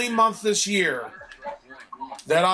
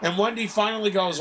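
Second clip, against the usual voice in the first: normal talking voice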